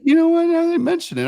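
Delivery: high-pitched sudden realization; realizing it. Retold or imitated. Retelling to a friend, a high surprised realization. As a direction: squeak it out as the penny drops. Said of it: "and I was like".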